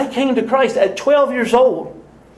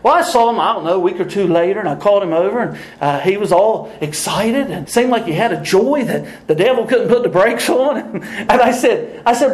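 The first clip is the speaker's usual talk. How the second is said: ordinary delivery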